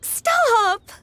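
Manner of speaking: high, stammered embarrassed outcry; flustered protest. Acted. Pupil stopping mentor's embarrassing childhood story, flustered protest. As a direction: cut them off, mortified and high-pitched.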